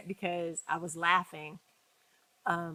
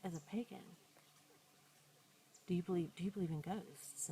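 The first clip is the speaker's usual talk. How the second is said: hushed question; 14 dB quieter than usual. Retold or imitated. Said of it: "And she goes".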